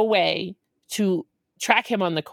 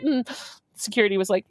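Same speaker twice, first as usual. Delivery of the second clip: ordinary delivery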